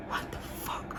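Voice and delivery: whispered disbelief. Retold or imitated. Disbelief at ignored labor pain.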